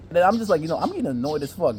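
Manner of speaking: normal talking voice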